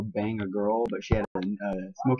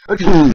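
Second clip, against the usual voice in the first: loud, explosive mock sneeze; acted sneeze; 5 semitones higher, 14 dB louder than usual. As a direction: burst out with an exaggerated achoo. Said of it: "And she sneezes and poops".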